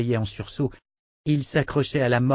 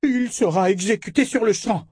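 high-pitched, imperious death order; outraged command; 11 semitones higher, 4 dB louder than usual. Acted. Enemy pronounces an execution on the spot, outraged and high.

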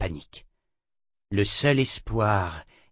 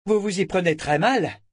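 defiant retort; 7 semitones higher than usual. Acted. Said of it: "lança-t-elle".

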